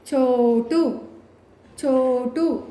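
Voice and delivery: "చో" held long in "చోటు"; drawn-out long vowel. Shown as a demonstration. Teacher joining the separated letters, long "చో". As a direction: stretch the first vowel of "చోటు". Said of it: "ఎక్కడ దీర్ఘం తీస్తున్నాము".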